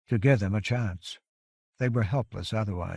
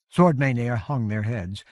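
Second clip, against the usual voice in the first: plain reading voice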